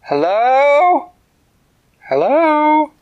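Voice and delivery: high, loud searching call with rising tune; yelling hello. Acted. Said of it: "Winona walked into the forest yelling".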